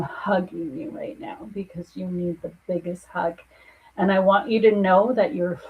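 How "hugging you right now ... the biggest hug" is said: tender whisper. Real, not acted. Psychic comforting a broken client.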